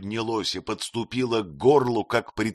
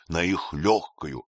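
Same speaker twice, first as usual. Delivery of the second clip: ordinary delivery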